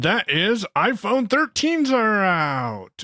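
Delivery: slow, drawn-out fake excitement; mock fanfare. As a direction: announce it with hollow fanfare. Announcing the iPhone with mock fanfare.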